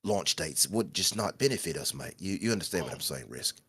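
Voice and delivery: quiet mock Brummie accent; lightly put-on Birmingham voice, mate; a hint of a Peaky Blinders accent. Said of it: "He's not even from Peaky Blinders".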